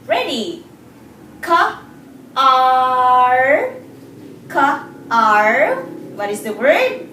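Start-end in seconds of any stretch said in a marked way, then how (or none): 1.4-5.8 Ka and ar split, loud and clear; sounding out words. Shown as a demonstration. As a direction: sound out Ka, ar for the class. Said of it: "Try to blend these sounds".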